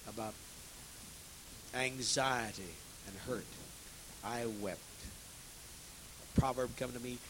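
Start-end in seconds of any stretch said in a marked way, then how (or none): none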